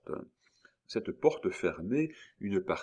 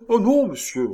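higher, louder, quick-to-protest exclamation; lively denial; 13 semitones higher, 11 dB louder than usual. A narrator's reading. Little man protesting to the stranger, lively. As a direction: burst out in brisk denial. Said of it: "répondit vivement le petit homme".

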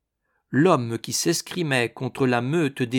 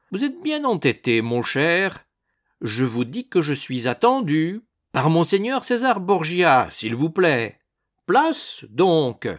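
raised, emphatic protest; impatient insistence; 6 semitones higher, 4 dB louder than usual. A narrator's reading. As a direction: insist on being let through. Ragastens argues with blocking guard. (protestation insistante, voix haute)

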